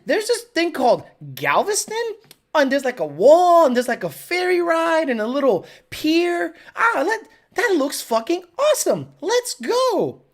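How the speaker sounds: high, gushing enthusiasm; mock excitement. Telling honey about Galveston, giddily keen. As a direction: sell the trip in a high excited voice. Selling beach trip to partner.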